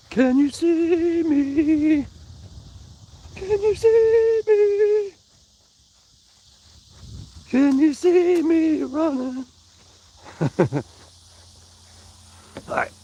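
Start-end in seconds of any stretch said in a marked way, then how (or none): 0.1-9.5 much higher, very slow and drawn out; high playful taunt, stretched out; sing-song teasing. Pretend. Teasing viewers while off camera.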